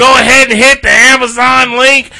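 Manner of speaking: loud, booming plug; loud sharp voice. Real, not acted. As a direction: belt the ad read out loud. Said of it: "Why are you screaming?".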